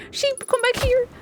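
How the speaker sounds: usual speaking voice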